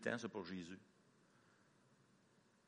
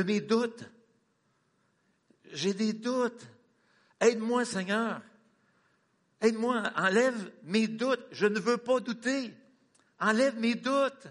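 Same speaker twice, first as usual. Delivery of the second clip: higher, slow, imploring; pleading prayer; 7 semitones higher, 4 dB louder, slower than usual. Acted. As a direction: beg the Lord to take away doubt. Pleading father's prayer voiced.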